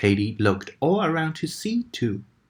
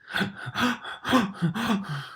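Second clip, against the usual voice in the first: out-of-breath gasp; 9 semitones higher than usual. A narrator's reading. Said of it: "almost out of breath".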